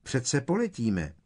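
slow, high, irritatingly serene; drawn-out, infuriatingly simple answer; maddeningly matter-of-fact. A narrator's reading. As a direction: say it as if it were obvious. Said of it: "tímtéž rozčilujícím hlasem".

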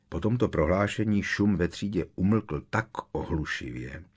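usual speaking voice

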